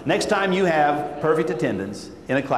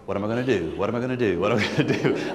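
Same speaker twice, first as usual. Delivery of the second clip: lower, quieter worried self-talk; a touch of panic under the breath; mild panicked muttering; 7 semitones lower, 3 dB quieter, faster than usual. Retold or imitated. Fretting to himself on the stairs, lower and quieter. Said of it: "going upstairs and going".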